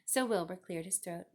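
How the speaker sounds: plain reading voice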